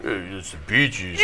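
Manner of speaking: low, slow, soft Stallone voice; imitating Sly. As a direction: drop into a low mumble. Brother's voice retold.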